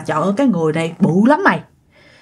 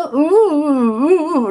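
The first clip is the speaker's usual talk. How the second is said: drawn-out, higher "ú" moans; stretched wordless moaning, pitched up; imitating muffled sleep moans; 6 semitones higher, much slower than usual. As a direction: mimic someone struggling to cry out in sleep. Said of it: "tao thấy mày cũng đang ú ớ".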